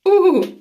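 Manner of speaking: a sharp high yelp of pain, loud; pained ooh. Real, not acted. Ripping off a nose strip, a loud high pained ooh.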